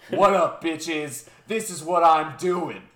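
loud brash announcement; cocky kid voice. Retold or imitated. Kid on stage shouting at the crowd, brash. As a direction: swagger onto the stage. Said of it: "he's like".

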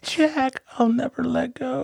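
high, soft, plaintive parody; hammed-up faint dying plea in a high voice; wispy, drawn-out melodramatic quote; mock film farewell. Quoting a film's parting line.

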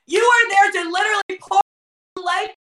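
high-pitched, urgent preaching; impassioned preacher's cry; forceful exhortation. Real, not acted. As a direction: raise the pitch and drive it at the worshipers.

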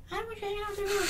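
high mock girlfriend telling him off. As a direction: mimic the girlfriend in a high, prim voice. Girlfriend quoted forbidding the hangout.

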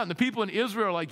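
ordinary talk

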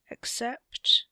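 probing prompt. A narrator's reading. Wesley presses Theo to finish his sentence, questioning. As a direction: prompt them to finish, voice lifting.

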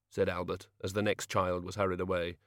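ordinary delivery

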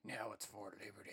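low, very soft afterthought; quiet aside. Real, not acted. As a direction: drop it softly under the act. Drops out of the Southern caricature.

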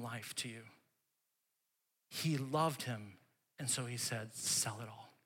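hushed, intimate preaching; hushed storytelling. Acted. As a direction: draw them in quietly.

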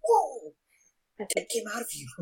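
high startled whoa; surprised whoa. Pretend. Acted shock in scene.

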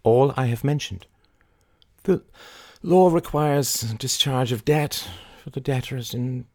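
formal, confident negotiating voice; Mary, businesslike. A narrator's reading. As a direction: lay out the terms firmly. Voices Mary stating terms.

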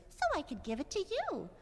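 high, sweet duckling answer. Acted. Duckling answers pigeon, high and sweet. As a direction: offer it kindly as a tiny duckling. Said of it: "the duckling says".

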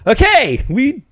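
high, loud playoff joy; excited yelling. Retold or imitated. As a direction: yell it like a fan. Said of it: "I was just like".

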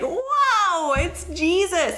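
high, drawn-out wow; reliving starstruck wonder; amazed awe. Retold or imitated. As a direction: soar up high and stretch it in amazement. Relives first hearing Jesus answer.

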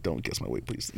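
low, pleading mock protest; mock plea. Driver begging the shop owner not to guess his weight, low mock plea.

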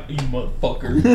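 mock grumbling. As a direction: curse low, as if to yourself. Acted grumble at noisy baby.

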